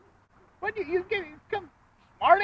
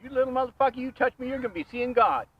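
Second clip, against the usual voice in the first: calm turning angry; 8 dB louder than usual. Acted. Park man threatens the hillbilly.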